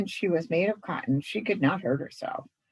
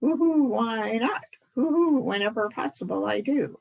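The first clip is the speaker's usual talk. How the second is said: higher hooted words, who who at the start; a light owl hoot opening each line; 6 semitones higher than usual. Acted. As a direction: owl explaining its hooting habit. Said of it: "start all your sentences with who".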